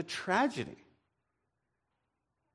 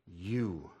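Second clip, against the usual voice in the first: softer, lower, grave accusation; solemn; 10 semitones lower, 7 dB quieter than usual. Acted. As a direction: let it land quietly. Prophet confronts David.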